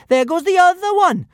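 put-on high, loud gloating girl; gleeful. A narrator's reading. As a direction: gloat happily.

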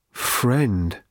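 a rusty, pitiful croak; pathetic croak. A narrator's reading. Broken robot croaking to his old friend, pitiful. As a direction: let the word crumble as you say it. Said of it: "croaked the robot pathetically".

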